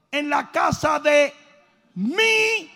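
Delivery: high, loud, slow shouted preaching; preaching at full force. Acted. Preacher cries out the prodigal son's hope to the congregation, full shout. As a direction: build the church to a roar.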